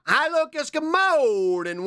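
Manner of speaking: high, slow sing-song good morning; high cutesy greeting. Pretend. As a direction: stretch the morning out, sweet and high. Greeting in a meme voice.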